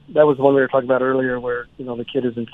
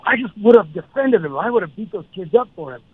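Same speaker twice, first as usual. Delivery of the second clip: retold yelling; 7 semitones higher than usual. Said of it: "he starts yelling".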